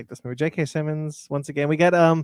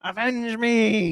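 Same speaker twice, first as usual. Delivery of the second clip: high, slow, put-on Goblin voice; slow Goblin impression; 7 semitones higher, 3 dB louder, much slower than usual. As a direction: drag it out like the Goblin. Quoting Norman Osborn.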